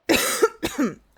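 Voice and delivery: dry hacking cough; coughing on dust. Real, not acted. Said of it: "I've gotta mute so I can cough".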